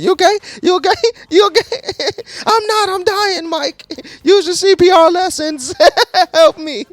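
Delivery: very high falsetto, laughing pleas; mock dying Annie. Annie begs Mike to use CPR, in high laughing falsetto. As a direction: ham up a dying victim.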